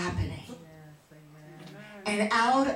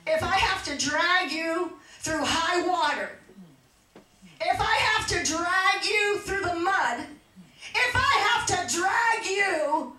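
impassioned vow, higher pitch, building line by line; fervent preaching; 8 semitones higher, 4 dB louder, slower than usual. Acted. Mother vowing to her daughter she will be saved, fervent preaching. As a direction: preach it as a mother's unshakable promise.

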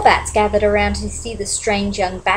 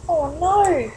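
high, soft suspenseful gasp; mock worried; 7 semitones higher, 3 dB quieter than usual. Real, not acted. Reader builds suspense.

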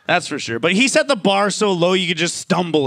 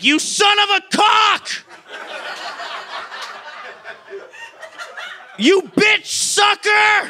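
much higher, shouted mock rage, curses mangled; garbled furious yell; 10 semitones higher, slower than usual. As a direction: scream like a dad too angry to curse right. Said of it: "Where he's like".

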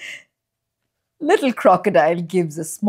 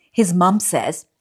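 ordinary delivery

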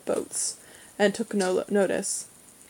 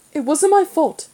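high, loud indignant denial; feisty, pitched-up retort; spirited protest; 8 semitones higher, 7 dB louder than usual. A narrator's reading. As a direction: stand up for yourself with spirit. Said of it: "she said with some spirit".

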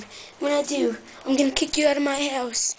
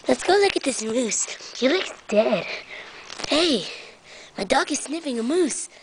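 ordinary delivery

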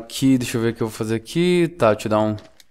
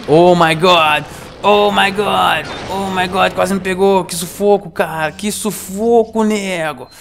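shaken relief; 7 semitones higher, slower than usual. Real, not acted. Relief after narrow escape.

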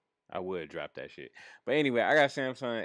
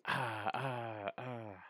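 mock crying; 2 semitones higher, 6 dB quieter, much slower than usual. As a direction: imitate her whining sobs. Imitates her reaction to refusal.

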